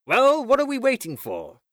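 very high, rousing challenge; commanding cry. A narrator's reading. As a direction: cry out impatiently to get them moving. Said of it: "cried the Chief".

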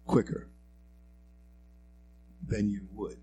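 normal talking voice

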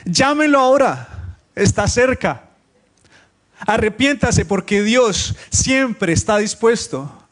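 high, loud, drawn-out proclamation; exclamatory scripture reading. Shown as a demonstration. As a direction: proclaim it slowly as an urgent call. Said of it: "Ahí es donde está el signo de admiración". (lectura bíblica exclamativa, con intención)

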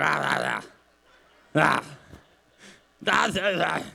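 mock caveman grunting; short gruff grunts, hammed-up; manly grunts. Pastor imitates Matt's grunting man talk, mock grunts. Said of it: "that's just his man talk".

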